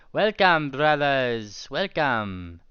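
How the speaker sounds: loud, grand greeting, high at first; welcoming griffin. Acted. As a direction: boom out a hearty welcome.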